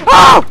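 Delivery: sudden loud gasping scream; startled yelp. Real, not acted. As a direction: cry out at a jump scare. Startled by jump scare in game.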